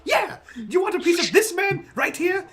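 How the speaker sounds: high shouting hype man. Acted. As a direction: pump up the crowd behind your buddy. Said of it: "straight up hype man from behind him".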